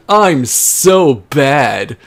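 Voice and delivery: slow mock-menacing Knuckles; villain voice. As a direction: ham up Knuckles boasting. Reading Knuckles' line in voice.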